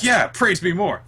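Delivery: high, eager demand for adoration; mock praise-hungry. Host acts out Noelle answering a compliment, mock greedy delight. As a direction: lap up the compliment, hammed up.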